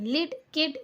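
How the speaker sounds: लिड, किड each with a quick short i; short i sound. Shown as a demonstration. Teacher showing pupils the correct short i. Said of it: "ऐसे पढ़ रहे हैं".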